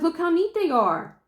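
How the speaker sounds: chirpy and pleased; high, proud little voice. Retold or imitated. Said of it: "I'm like".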